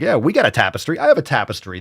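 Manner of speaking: ordinary talk, nothing marked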